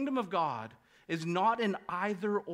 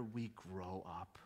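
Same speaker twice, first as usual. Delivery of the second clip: much softer, slow and low; hushed punchline; 11 semitones lower, 14 dB quieter, slower than usual. Real, not acted. Preacher landing the point to the church, hushed. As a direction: drop it to a near hush. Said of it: "repeated punchline".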